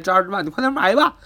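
a hammed-up scammer's hard sell; mock pushy seller. Scammer urging a buyer.

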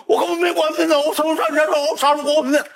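high, insistent denial; mock protest. Boy denying to the attendant, high and pleading. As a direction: protest your innocence, voice way up.

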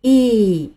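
"E" said as a long English ee; 英文字母E的读音; English letter E. Shown as a demonstration. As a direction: model "E" as a clear stretched ee. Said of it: "再来一次".

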